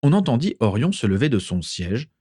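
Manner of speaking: ordinary delivery, nothing marked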